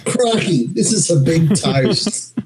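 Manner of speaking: put-on high, slow Australian accent; Steve Irwin impression. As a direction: enthusiastic Aussie wildlife host. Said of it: "Steve Irwin".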